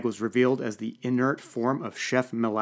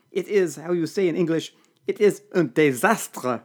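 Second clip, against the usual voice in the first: put-on sobbing French accent; 6 semitones higher, 5 dB louder than usual. A narrator's reading. Serge near tears over the ruined dinner, French accent. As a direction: wail the disaster in heavy French. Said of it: "actually began to sob".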